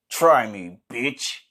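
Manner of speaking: ordinary talk, nothing marked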